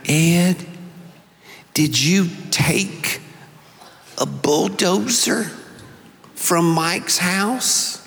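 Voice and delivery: lightly voiced mother with a Mississippi accent, slow; a hint of a Southern mom drawling the question. Mom questioning her son in a mild Mississippi accent. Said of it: "she said in her Mississippi accent".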